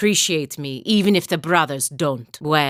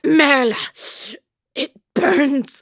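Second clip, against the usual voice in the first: crying out in pain; 10 semitones higher, slower than usual. Acted. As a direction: yell it as the burn hits. Cries out in pain from burning.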